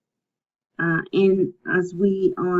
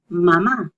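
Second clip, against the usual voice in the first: "mamá" with the second ma stressed; stress on last syllable; 4 dB louder than usual. Shown as a demonstration. Teacher showing the accent mark, "mamá" stressed on the end. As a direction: lean on the final á in "mamá". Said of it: "there's emphasis on that".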